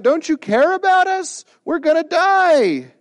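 high, loud, desperate plea to be saved; panicked cry. Retold or imitated. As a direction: cry out like terrified fishermen in a storm. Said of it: "they cry out".

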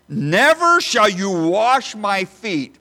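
loud, slow, pounding every word; emphatic refusal. Acted. Peter refusing Jesus, loud slow emphatic refusal. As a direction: plant your feet and refuse. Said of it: "he becomes very emphatic".

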